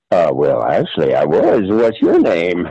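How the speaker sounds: low-pitched propositioned man; deep, tentative pickup voice; hustler's customer. Acted. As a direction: answer way down low, a bit hesitant.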